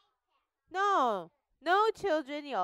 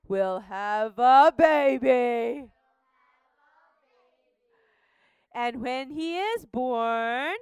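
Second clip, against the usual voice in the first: angel's announcement; 5 dB louder, slower than usual. Acted. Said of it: "Say it".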